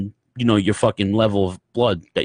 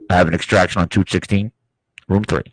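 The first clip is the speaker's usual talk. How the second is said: walkie-talkie nurse; 6 semitones lower than usual. Retold or imitated. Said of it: "I repeat".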